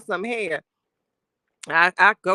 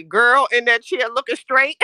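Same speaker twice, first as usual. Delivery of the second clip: ordinary talk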